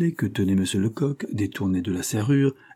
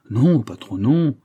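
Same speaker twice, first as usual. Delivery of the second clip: louder protesting cry; loud eager denial, non pushed higher; cried out; 3 semitones higher, 6 dB louder, slower than usual. A narrator's reading. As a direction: burst out in protest to the boss. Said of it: "s'écria Fanferlot".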